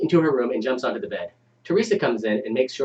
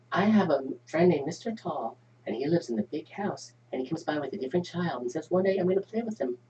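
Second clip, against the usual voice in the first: put-on child voice; 4 semitones higher, 6 dB quieter than usual. Child voice in read script.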